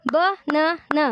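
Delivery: Banana split into three even beats; syllable by syllable. Shown as a demonstration. Teacher repeats for the class, banana in separate syllables. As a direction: chant out banana one syllable at a time. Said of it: "Let's repeat again".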